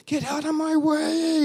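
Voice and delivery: high, drawn-out mock holler; impatient driver's yell, replayed; imitated yelling. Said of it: "just yelling and calling me names".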